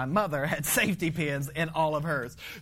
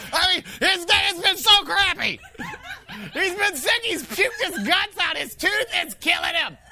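higher, louder, laughing through the list; gleeful mock lament; laughing exaggeration; 11 semitones higher, 5 dB louder than usual. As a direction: pile it on, half laughing. Mock narration, high and laughing.

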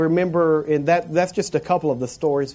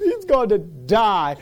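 slow, higher mock lament; mock crying; 5 semitones higher, slower than usual. Cousin crying that the boy will die, mock wailing. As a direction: draw out a cousin's tearful verdict. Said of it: "and they're crying".

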